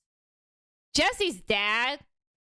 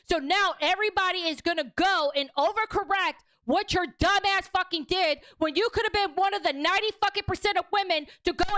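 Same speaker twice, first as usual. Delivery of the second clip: real rage, every swear spat out; raging rant; 6 semitones higher than usual. Real, not acted. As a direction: let it all out in a screaming rage. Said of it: "I just raged out".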